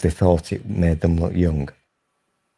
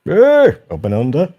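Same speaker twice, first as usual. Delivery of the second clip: loud commentator impression; 6 semitones higher, 5 dB louder than usual. Imitating Eddie Waring catchphrase.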